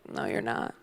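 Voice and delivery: whispered, low flat no; flat whispered retort. Retold or imitated. Girlfriend calling out the boyfriend, low whispered retort. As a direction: breathe it out, deadpan. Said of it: "And I'm like".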